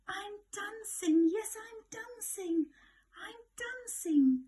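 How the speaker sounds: high, loud delighted cry; joyful cry. Acted. Gerald dancing under the moon, ecstatic high cry. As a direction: burst out with joy. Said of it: "Gerald cried".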